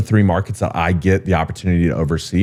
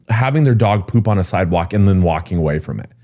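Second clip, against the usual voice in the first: ordinary talk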